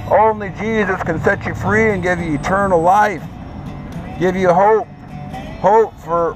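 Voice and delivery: usual speaking voice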